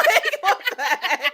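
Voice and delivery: high, laughing what the heck; amused disbelief, laughing. Real, not acted. Incredulous, laughing.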